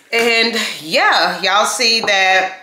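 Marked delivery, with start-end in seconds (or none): none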